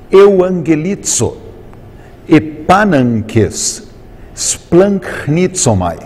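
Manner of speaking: "euangelizo, epananques, splanchnitsomai" said slowly, syllable by syllable, gamma sounding as N; slow Greek pronunciation. Shown as a demonstration. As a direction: spell out each Greek word slowly so the N sound is clear. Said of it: "Por exemplo, nessas palavras". (pronúncia grega lenta, sílaba por sílaba)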